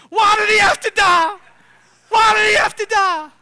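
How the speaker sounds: high, loud sobbing wail; crying yell. Retold or imitated. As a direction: howl it in mock grief over the grave. Grieving man wails at grave.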